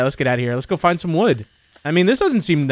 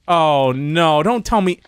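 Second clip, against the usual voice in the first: ordinary talk, nothing marked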